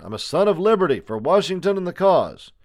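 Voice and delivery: high, loud rallying cry; shouted declaration. A narrator's reading. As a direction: proclaim your cause to those outside. Prisoner shouts his allegiance.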